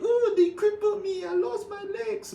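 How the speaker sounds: mock the crying players, slowing on the last words; mock whining player. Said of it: "players crying online saying".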